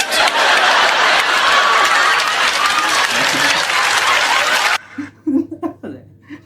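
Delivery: loud, high burst of laughter; long uncontrolled fit of laughing; real laugh. Real, not acted. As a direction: crack up hard at a joke. Reacting to the comedian's clip.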